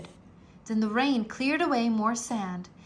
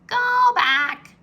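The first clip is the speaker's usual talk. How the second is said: high, loud parrot call; 14 semitones higher, 10 dB louder than usual. A narrator's reading. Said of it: "squawked Polly".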